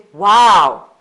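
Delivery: loud, amazed Wow; an excited gasp of Wow; wow exclamation. Shown as a demonstration. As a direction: say it like something amazing happened. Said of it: "you say".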